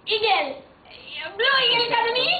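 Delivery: high, loud eager blurting; excited shouted guess. Real, not acted. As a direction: shout the answer out, thrilled.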